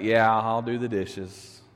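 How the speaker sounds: put-on low, slow, beaten kid giving in; resigned mumble of a trapped boy; defeated kid. Boy to sister, giving in low and slow.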